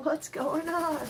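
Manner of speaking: a light puzzled pet voice; voicing the ferret. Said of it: "Jack's just laying there like".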